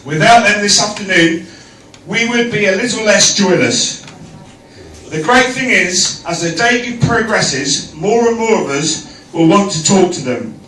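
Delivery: formal toast to the room; wedding toast. Acted. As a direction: raise the glasses, speak up.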